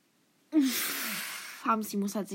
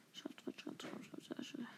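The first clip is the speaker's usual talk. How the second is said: very soft, voiceless repeated whisper; whispering to oneself; 20 dB quieter than usual. Real, not acted. Gamer keeping track to themselves, whispering. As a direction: whisper it under your breath. Murmurs to self while typing.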